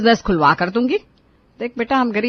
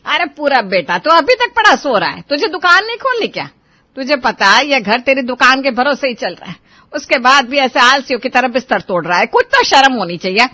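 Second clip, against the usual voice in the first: nagging mother's voice, loud and sharp; scolding mother; 4 dB louder than usual. Acted. Mother scolding her sleeping son, nagging loudly. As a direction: tear into a lazy son at dawn.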